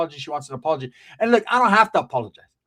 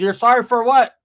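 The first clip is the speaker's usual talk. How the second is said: loud, raised mock challenge; indignant aunt imitated; 6 semitones higher, 9 dB louder than usual. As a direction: throw the apology back, high and loud. Aunt challenges his apology.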